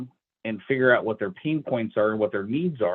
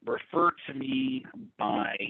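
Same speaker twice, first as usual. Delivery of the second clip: usual speaking voice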